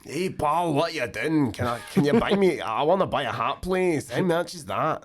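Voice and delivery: high, hammed-up comedy character; silly character voice. Comedian showing off his stage characters, silly high-pitched voice. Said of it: "silly, explicit characters like that".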